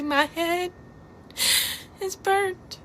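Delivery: rising, cracking with grief; real grief breaking through. Real, not acted. Reliving mother's screaming, voice breaking.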